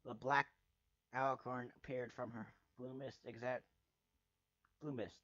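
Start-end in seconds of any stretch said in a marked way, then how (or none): none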